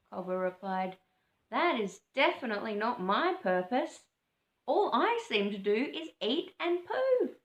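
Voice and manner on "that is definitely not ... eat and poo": put-on lightly raised, puzzled young bird; a bit higher, a bit bewildered; Cobber's voice. Cobber confessing he only eats and poos, lightly raised.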